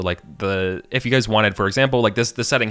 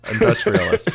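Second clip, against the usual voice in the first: pitched way up in disbelief; incredulous; 11 semitones higher than usual. Real, not acted. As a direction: squeak it out in surprise. Mocking emphasis on a word.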